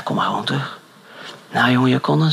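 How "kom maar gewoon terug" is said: soft unvoiced whisper; hushed. Pastor quietly calling the boy back, whisper. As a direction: hush it gently.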